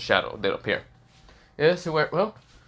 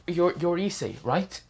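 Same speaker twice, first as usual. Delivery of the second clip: lightly voiced girl, pitched higher; a girl calling out from behind, higher; girl's voice; 9 semitones higher than usual. Girl behind Issei on the bridge, lightly higher voice.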